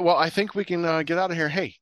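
normal talking voice

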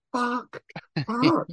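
high-pitched, drawn-out comic swearing; overwhelmed mock awe. Retold reaction to Walmart goodies section.

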